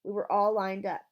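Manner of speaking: usual speaking voice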